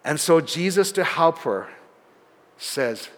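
ordinary talk

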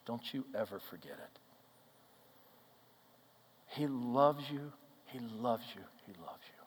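hushed reassurance to a crying server; tender, retold. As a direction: lean in close and say it gently. Telling crying server she is loved.

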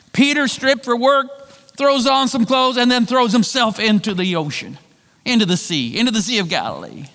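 ordinary talk

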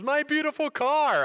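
high, loud mock wail over the car; high-pitched wifely lament, hammed up. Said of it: "She goes".

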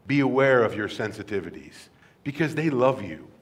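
ordinary talk

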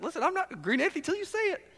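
high, quick imitation of a holdout; mock wary listener; wary congregant.

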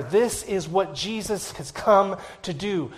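normal talking voice

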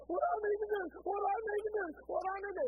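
high, loud mock desperate wail; imitated crying plea. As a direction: plead like a biker breaking down, repeating it urgently. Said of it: "finally, he starts crying and says".

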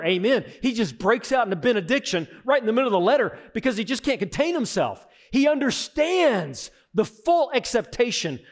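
loud, high, fervent preaching on Christ and praise; full-force preaching. Acted.

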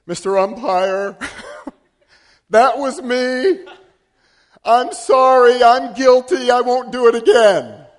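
loud, high, slow laughing confession; loud sheepish apology. Retold or imitated. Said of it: "I did say".

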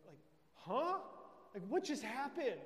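high, rising startled question; bewildered sinner. Acted. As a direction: act blindsided and baffled. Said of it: "And you're like".

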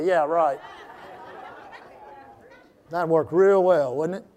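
mock the idea in a low dry voice; sarcastic. Real, not acted. Scoffing at bossing his wife.

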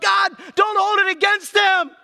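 high, full-force shout to God; desperate yell. Acted. Stephen under the stones yelling forgiveness, full force. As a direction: yell it with your last breath. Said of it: "he just yells out".

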